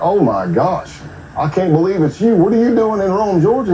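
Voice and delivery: high, overjoyed fake greeting; feigned delighted surprise. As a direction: act thrilled to bump into an old friend. Fake surprise greeting to fool suspect.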